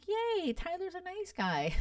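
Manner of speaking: high-pitched little yay; mock cheer. Vlogger cheering Tyler for helping someone have kids, high mock cheer.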